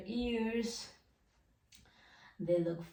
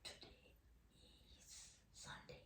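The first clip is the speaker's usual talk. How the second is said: soft unvoiced whisper, very quiet; whisper in left ear; 29 dB quieter than usual. Shown as a demonstration. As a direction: breathe the words close to the ear. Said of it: "I'm gonna whisper something in your left ear".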